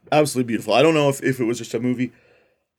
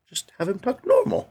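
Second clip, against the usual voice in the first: put-on much higher-pitched child's protest; imitating little girl; 14 semitones higher than usual. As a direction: lightly mimic a small girl objecting. Retells the girl's protest.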